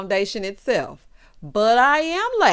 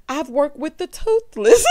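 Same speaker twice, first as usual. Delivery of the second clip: higher and louder as the laugh takes over; retold line collapsing into real laughter; breaking into laughter; 6 semitones higher, 5 dB louder than usual. Real, not acted. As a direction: quote it while losing composure.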